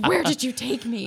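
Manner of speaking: loud retold demand; acted indignation. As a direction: replay an outraged question at full volume. Acted quarrel with boyfriend.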